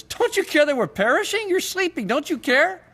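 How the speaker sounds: high-pitched, acted-out cry of protest; panicked yelling. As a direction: yell it up high like desperate accusers. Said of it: "they're yelling at Jesus".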